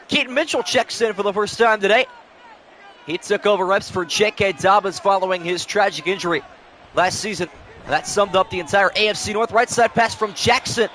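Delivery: lively sportscaster calling the play. Acted. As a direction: call it like a TV broadcaster. Madden play-by-play call.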